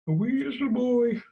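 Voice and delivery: put-on high squeaky little boy. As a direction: squeak like a tiny kid.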